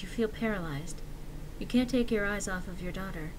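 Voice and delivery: ordinary delivery, nothing marked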